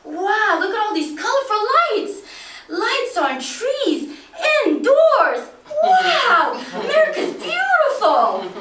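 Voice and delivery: high, loud, laughing amazement; a child seeing Christmas lights for the first time; boy marvelling at American lights; excited wonder. Retold or imitated. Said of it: "I get so excited".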